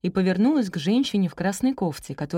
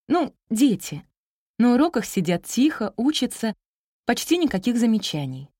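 higher, emphatic teacher voice; eager young teacher, reassuring; 4 semitones higher, 4 dB louder than usual. Acted. Young teacher reassuring wary parents about their children, eager. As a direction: praise them brightly, correcting yourself.

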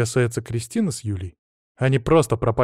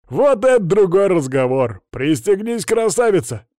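loud, high, rousing driver voice; gleeful driver; 7 semitones higher, 5 dB louder than usual. A narrator's reading. Driver tells his passenger to buckle up, gleeful. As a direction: whoop it up as the driver.

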